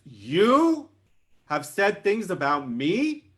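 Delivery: loud, high, drawn-out disbelief; incredulous challenge. A narrator's reading. King looks down on small ant, incredulous and affronted. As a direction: question it slowly as an affronted king.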